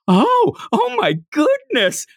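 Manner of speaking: high, drawn-out exaggerated gasp of wonder; mock amazement. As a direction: act hugely impressed, stretching it out. Acting out her impressed, swooning reaction.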